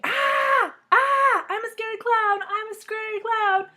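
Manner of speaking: high, very loud playful scream; kid scream. Acted. Child scaring grandma, high loud scream. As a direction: shriek to scare grandma. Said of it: "I kept on screaming".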